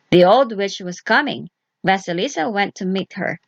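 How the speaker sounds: ordinary delivery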